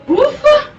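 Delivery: a high, loud ufa of relief; relieved sigh. Acted. Girl escaping the cow lets out a high relieved ufa. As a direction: blow out the relief after the escape.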